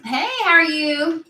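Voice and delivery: put-on high, loud Valley Girl greeting. Shown as a demonstration. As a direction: chirp hello like a Valley Girl. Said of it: "Did it sound a little Valley Girl?".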